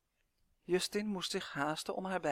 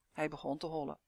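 usual speaking voice

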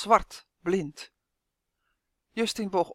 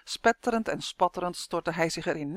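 plain reading voice

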